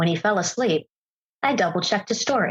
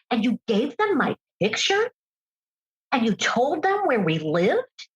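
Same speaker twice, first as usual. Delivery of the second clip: higher, incredulous, mounting anger without real shouting; retold outraged confrontation; 7 semitones higher than usual. A speaker retells confronting her husband over the online couple, rising higher with incredulous anger. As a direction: demand answers in mock disbelief.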